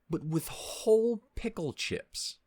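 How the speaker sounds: high, slow, incredulous; outraged disbelief. Real, not acted. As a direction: drag it out in horror. Outraged reveal of pickle chips.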